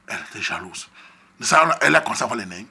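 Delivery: ordinary talk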